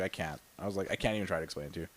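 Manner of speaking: very low, faint spent voice; drained. Retold or imitated. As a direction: barely voice it, all used up. Retold reply, spent from laughing.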